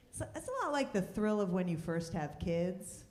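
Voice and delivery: ordinary delivery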